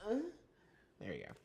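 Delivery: ordinary talk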